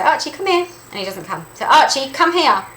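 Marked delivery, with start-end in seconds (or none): 0.0-0.7 high-pitched recall call; calling the dog. Shown as a demonstration. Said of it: "when I call back my dog using my voice". 1.7-2.7 louder, slower, insistent recall; frustrated dog call. Shown as a demonstration. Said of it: "I'm gonna feel frustrated".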